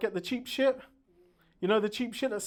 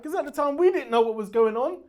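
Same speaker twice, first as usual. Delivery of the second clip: ordinary delivery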